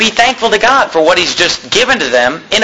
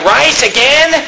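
higher-pitched incredulous grumble; mock complaint; 7 semitones higher, slower than usual. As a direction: imitate a fussy eater objecting. Mimics a complaint about repeated food.

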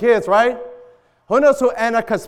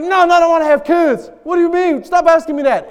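flustered Pearl; 10 semitones higher, 6 dB louder than usual. Retold or imitated. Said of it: "And she's like".

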